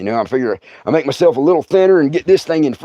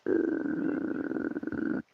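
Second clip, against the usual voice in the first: a soft breathy growl; low growl; 16 dB quieter than usual. Retold or imitated. Bear growls behind the door.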